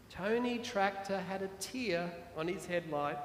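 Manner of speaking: ordinary delivery, nothing marked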